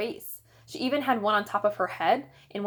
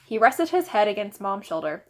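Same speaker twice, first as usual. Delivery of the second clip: usual speaking voice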